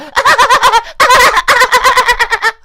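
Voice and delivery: high burst of laughter; loud laugh. Real, not acted. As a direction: cackle loudly. Laughing at chat.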